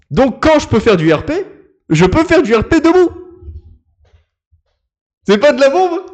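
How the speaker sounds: très fort et haut perché; enthousiasme bruyant. Real, not acted. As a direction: exulte à pleine voix. S'emballe pour le RP debout. (surexcité, presque crié)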